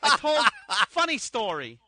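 brash New York voice with laughs; New York accent. Pretend. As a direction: talk like a loud New York guy. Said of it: "my accent there".

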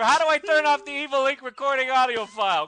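calling out. Acted. [name] calling honey to help stop recording, loud mock call. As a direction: holler for help, comically clueless.

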